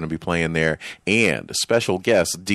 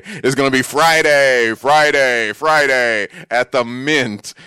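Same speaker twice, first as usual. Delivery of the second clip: higher, louder hype announcer voice; monster truck ad; 6 semitones higher, 6 dB louder than usual. Pretend. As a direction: hammer each Friday like an arena ad. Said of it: "Sound like one of those monster truck guys".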